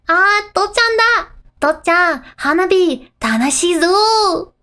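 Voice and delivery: put-on high, excited child calling out to dad; gleeful, loud little-kid voice; Yotsuba. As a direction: shout the happy news in Japanese. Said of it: "Yotsuba's so happy".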